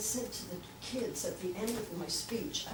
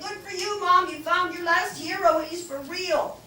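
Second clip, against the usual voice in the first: excited; 10 semitones higher, 8 dB louder than usual. Retold or imitated. Daughter quoted, high loud.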